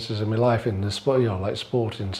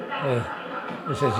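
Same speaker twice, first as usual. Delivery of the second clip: usual speaking voice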